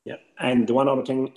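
normal talking voice

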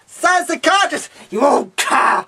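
ordinary talk

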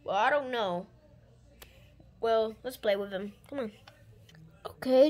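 ordinary talk

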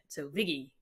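Viggy said the New York way; Vicky as Viggy with a soft g; New York accent. Shown as a demonstration. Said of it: "Vicky with the New York accent".

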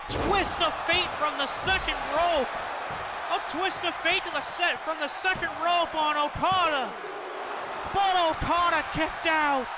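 high, excited play-by-play calling; wrestling commentator. Acted. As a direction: call the big moves hyped up, ringside. Live play-by-play of a wrestling match.

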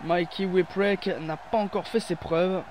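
ordinary delivery

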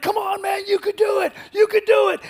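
high shouted encouragement; gym buddy cheering. Retold or imitated. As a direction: yell it like a spotter at the bench. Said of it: "they're like".

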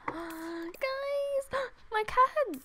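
high-pitched, gasping excitement; excited squeak. Real, not acted. Gamer telling viewers about her cat's eyes, excited squeak.

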